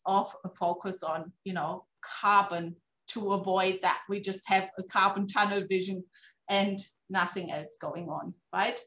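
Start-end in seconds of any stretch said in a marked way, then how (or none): none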